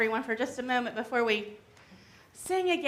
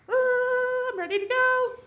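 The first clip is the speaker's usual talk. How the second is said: much higher squeak of discomfort; uncomfortable child; 10 semitones higher than usual. Pretend. Child voicing a hug going on too long, higher. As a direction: squirm out of the hug. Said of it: "from wonderful to".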